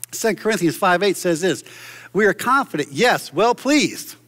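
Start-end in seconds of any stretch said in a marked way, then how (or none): 2.1-4.1 excited Paul. Acted. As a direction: read it like Paul getting excited. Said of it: "Paul's getting excited".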